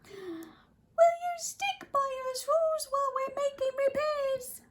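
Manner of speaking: very high little voice pleading; tiny Who mayor pleading. Acted. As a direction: plead up from the speck. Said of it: "pleaded the voice".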